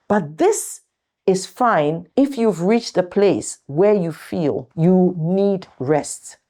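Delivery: usual speaking voice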